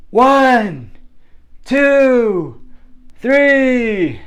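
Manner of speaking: high, loud, drawn-out count; bullhorn counting. Retold or imitated. Teacher counting down on boys through bullhorn, loud calling. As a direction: call each number across a playground. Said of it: "she got her bullhorn".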